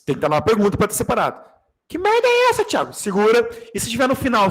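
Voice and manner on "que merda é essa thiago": much higher and louder, a hammed-up outraged cry; mock outraged yell. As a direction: shriek it like a scandalized student. Acted angry outburst at student. (grito indignado exagerado)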